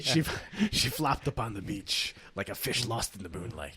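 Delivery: low, soft hard-boiled narration; noir detective. Acted. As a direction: brood like a noir gumshoe. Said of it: "the noir?".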